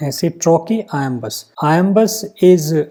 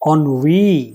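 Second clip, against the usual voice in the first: ennui with the stress on its final "wee"; on-WEE, leaning on the end of "ennui"; stress on second syllable; 2 semitones lower than usual. Shown as a demonstration. Teacher showing where stress falls, ennui as "on-WEE". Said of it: "तो वी पर stress".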